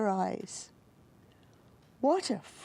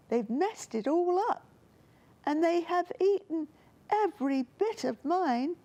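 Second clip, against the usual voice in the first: high, whiny cub voice wailing; a tiny bear complaining his porridge is gone; wailing little bear; 8 semitones higher than usual. A narrator's reading. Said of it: "wailed Little Bear".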